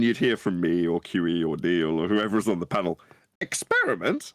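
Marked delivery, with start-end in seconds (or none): 3.4-4.3 "Experiment?" asked with a big upward lift at the end; upward inflection. Retold or imitated. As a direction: let "Experiment?" swing far up, a questioning jab. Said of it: "in this upward inflection".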